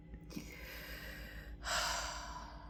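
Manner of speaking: long, soft breathy exhale; long sigh. Real, not acted. Emotional after talk of vulnerability, a long soft sigh. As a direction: let out a slow, quiet sigh.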